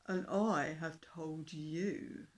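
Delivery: loud, slow, bragging beast voice; nasty dinosaur. A narrator's reading. Dinosaur boasts nastily to the fish king, slow and loud. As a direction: lean in and gloat over the little fish. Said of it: "said the dinosaur nastily".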